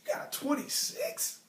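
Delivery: high, breathy squeal of disbelief; shocked disbelief. Retold or imitated. Telling a classmate he scored badly, squealing in shock. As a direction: gasp out the score like it's unbelievable. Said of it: "I was like".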